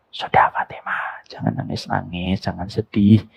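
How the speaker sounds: soft hushed whisper to a crying daughter; whispered comfort. Retold or imitated. Father whispering to weeping Fatimah, hushed and gentle. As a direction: breathe it gently, unvoiced at first. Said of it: "Bisikan pertama".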